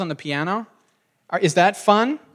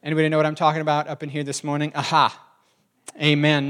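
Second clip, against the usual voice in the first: quick-fire preacher cadence with punched interjections; 2 semitones lower, faster than usual. Shown as a demonstration. Younger self preaching to the congregation, preacher voice. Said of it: "preached in a preacher voice".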